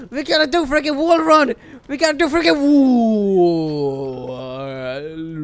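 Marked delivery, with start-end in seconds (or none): 2.6-5.4 slow, deep warped drone. Acted. Gamer dragging the words into a slowed warped drone. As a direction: stretch it out like a slowed tape.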